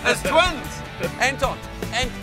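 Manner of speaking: normal talking voice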